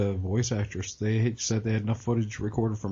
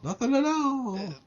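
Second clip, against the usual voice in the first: teasing refrain; 10 semitones higher than usual. Retold or imitated. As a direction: let each repeat soar and stretch out. Quoting Flanders' taunting refrain.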